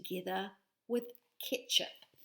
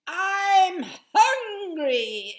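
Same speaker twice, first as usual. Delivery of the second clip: loud, slow dragon voice; dragon roar; 11 semitones higher, 15 dB louder, much slower than usual. Acted. Dragon perched on the steeple, loud slow roar. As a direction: roar it out like a hungry beast. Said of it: "it roared".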